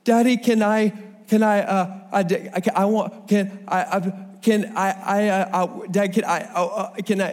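put-on a small boy tripping over his words to ask his dad; flustered little kid. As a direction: stumble like a three-year-old who can't get the words out. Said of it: "he starts going".

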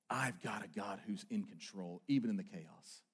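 hushed statement of faith; quiet trust. Acted. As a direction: say it like a private confession. Intimate confession of trust.

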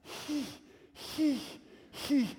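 high, short breathy bursts of "he"; panting he. Retold or imitated. Boy sputtering at his dad, high panting bursts. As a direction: puff like Lamaze breathing. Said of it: "he was just".

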